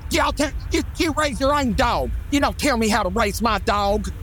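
imitating the dog owner's offended snapping back; indignant dog owner. Dog owner snapping at a stranger who scolded her, mock indignant high voice. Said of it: "she got so indignant".

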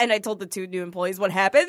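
normal talking voice

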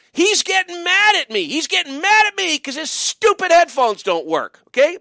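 high, heated rant; exasperated venting. Real, not acted. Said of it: "The angst is coming".

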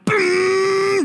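long, high, loud vroom; sustained engine noise made with the mouth; imitated engine roar. Truck speeding off to Jairus' house, loud imitated engine.

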